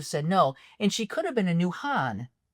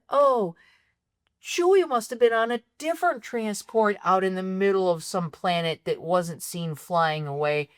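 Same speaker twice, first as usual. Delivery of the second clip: ordinary talk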